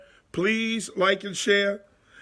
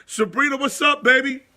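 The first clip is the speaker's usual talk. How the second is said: higher, louder hype hello; excited greeting; 6 semitones higher, 4 dB louder, faster than usual. Real, not acted. Host greets listener Sabrina on air, excited and loud.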